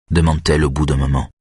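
ordinary delivery

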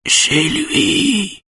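thin, drawn-out high squeal; high-pitched voice. A narrator's reading. Schulman answers where he was, high-pitched voice. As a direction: force the answer out in a strained falsetto. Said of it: "répond Schulman d'une voix aiguë".

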